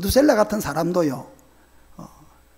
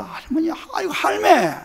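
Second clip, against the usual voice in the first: higher Gyeongsang calling to grandma; Geoje dialect call; 8 semitones higher than usual. Retold or imitated. Child calling grandmother in Geoje dialect. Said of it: "제가 거제도 사람입니다".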